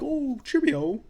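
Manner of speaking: mock British accent. Snobby actor greeting kids, mock British accent. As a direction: stretch it out like a posh British snob. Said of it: "you can tell he's putting on an accent".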